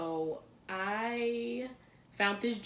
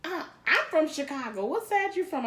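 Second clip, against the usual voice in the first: quoting Mona; 9 semitones higher, 5 dB louder than usual. Retold or imitated. Quoting Mona's challenge.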